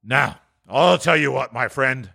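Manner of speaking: slow, gruff mock-stern boss; feigned sternness. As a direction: draw out the warning in a put-on stern voice. Scrooge feigns severity to clerk.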